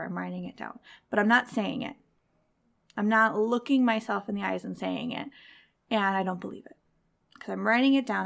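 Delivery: normal talking voice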